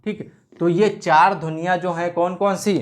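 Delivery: ordinary talk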